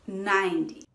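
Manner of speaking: "ninety" stressed on "nine", weak "ty". Shown as a demonstration. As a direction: lean on the first syllable of "ninety". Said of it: "stress is on the first syllable".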